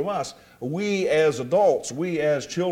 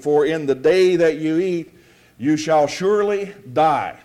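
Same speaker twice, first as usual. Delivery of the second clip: louder, measured Bible reading for the congregation; scripture reading; 5 dB louder than usual. Acted. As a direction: read the verses out firmly, like a preacher. Reads scripture aloud.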